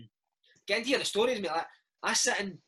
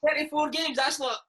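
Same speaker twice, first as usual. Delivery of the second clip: mock outrage; 7 semitones higher, 4 dB louder than usual. Real, not acted. Mock outrage at Slaney's label.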